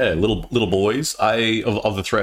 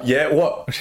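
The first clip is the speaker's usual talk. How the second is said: higher questioning call back; retold reply; 6 semitones higher than usual. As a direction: answer a friend who keeps hollering. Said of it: "a hundred and fifty meters away".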